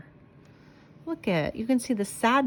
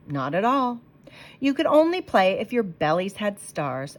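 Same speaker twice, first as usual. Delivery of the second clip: plain reading voice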